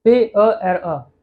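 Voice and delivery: "पे अ एर अ" spelled slowly, letter by letter; slow spelling. Shown as a demonstration. Teacher spelling the French word for father, slow. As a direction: spell out "पे अ एर अ" deliberately. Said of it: "दूसरा word देखें".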